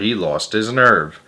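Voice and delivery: ordinary delivery, nothing marked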